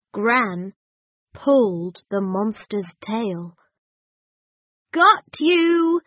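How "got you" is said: high and loud gotcha; triumphant. A narrator's reading. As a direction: pounce with glee. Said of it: "she said".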